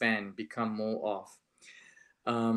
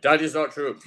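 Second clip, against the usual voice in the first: firm, raised defiant denial; 5 semitones higher, 9 dB louder than usual. Retold or imitated. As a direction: flatly refuse the lie, louder. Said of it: "I do that with a very defiant voice".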